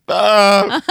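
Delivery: short high startled yelp; sudden scream. Retold or imitated. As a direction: blurt out a sharp loud cry. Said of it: "and she just goes".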